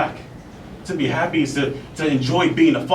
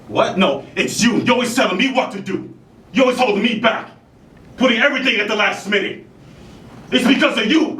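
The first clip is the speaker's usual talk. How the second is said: high, heated outburst; angry accusation; 9 semitones higher, 3 dB louder than usual. Acted. Actor blaming the one who holds them back, angry. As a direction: hurl the blame at them. Acted quarrel, blaming the other.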